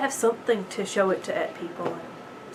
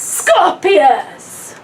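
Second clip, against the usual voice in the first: calling out loudly, higher; called out; 6 semitones higher, 6 dB louder than usual. Acted. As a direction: cry the name out, drawn out. Script read-through, calling out name.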